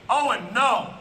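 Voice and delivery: a hint of a stern dad, "no" falling firm; lightly done stern father stopping a toddler. Uncle warning his nephew off the socket, firm dad voice. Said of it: "I'll give him the dad voice".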